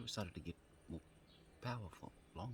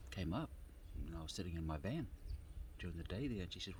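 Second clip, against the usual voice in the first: normal talking voice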